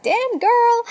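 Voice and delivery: put-on high, loud sassy "Damn girl". Shown as a demonstration. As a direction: throw it out like a meme, big and high.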